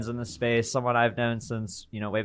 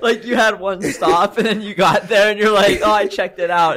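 laughing mockery; 8 semitones higher, 7 dB louder than usual. Real, not acted. Host mocking guest's Uber stop.

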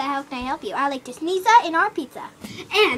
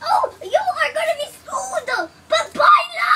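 excited shout; 11 semitones higher than usual. Pretend. Caller to clerk about the lost hippo, high laughing yell. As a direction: cry out in mock outrage.

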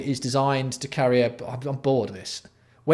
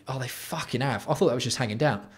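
ordinary delivery

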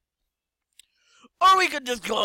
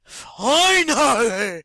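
triumphant shout; 4 semitones higher, 9 dB louder, much slower than usual. Real, not acted. Relieved triumph.

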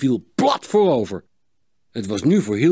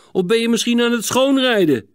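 high-pitched, teasing exclamation; calling out; 10 semitones higher, 4 dB louder than usual. A narrator's reading. Gerben teases Sietse skating alone, calling out. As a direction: call a jibe across the track. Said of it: "riep Gerben uit".

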